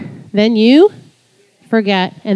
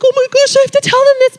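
much higher, thrilled squeal; giddy rush of joy; excited; 3 dB louder, faster than usual. Retold or imitated. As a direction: burst with the need to tell. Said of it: "I was just so excited".

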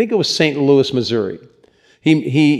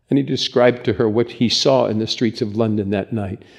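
usual speaking voice

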